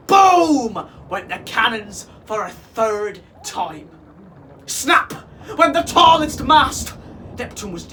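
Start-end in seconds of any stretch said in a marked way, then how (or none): 0.1-0.9 loud, drawn-out falling blast; big storybook boom; booming sound word. A narrator's reading. 4.7-6.9 high, loud storytelling burst; excited storybook climax; dramatic narration. A narrator's reading. Narrator dramatizes the mast breaking.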